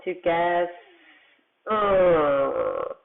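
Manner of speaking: "To guess, uh" stretched sound by sound, long final "uh". Shown as a demonstration. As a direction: draw out each piece of "To guess, uh". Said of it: "sound out big words".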